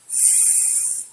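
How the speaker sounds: hissed s sound; a long hissing sss like a snake; teacher's slow sss; letter sound s. Shown as a demonstration. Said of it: "I'm going to say each sound".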